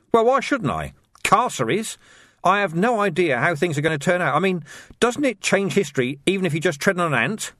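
a touch of defensive protest. Acted. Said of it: "added defensively".

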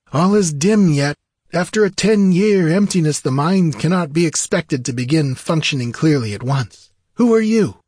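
lofty high-pitched sorcerer, questioning; dazed freed sorcerer. A narrator's reading. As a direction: speak high and forceful, groping back to clarity.

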